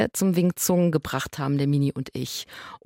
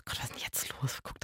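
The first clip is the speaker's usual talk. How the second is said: unvoiced inner-thought whisper; 5 semitones lower, 17 dB quieter than usual. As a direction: whisper "Gott, was ist denn jetzt los?" to yourself. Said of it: "Und ich dachte". (geflüsterter Gedanke)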